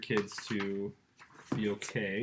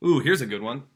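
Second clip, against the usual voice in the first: excited find; 2 semitones higher, 11 dB louder than usual. Real, not acted. Finds a word to show.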